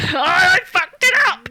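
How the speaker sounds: hammed-up despair; mock panic. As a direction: wail that she blew it, half laughing. Retold panic over bad take.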